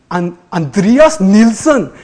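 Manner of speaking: put-on slow, careful Swedish accent on the name. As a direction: say the name like a Swede. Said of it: "that was my Swedish, uh, uh, accent".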